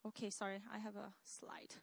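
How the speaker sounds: low, hushed apology under the breath; quiet aside. Real, not acted. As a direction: drop almost to a whisper, as an aside. Aside while finding the slide.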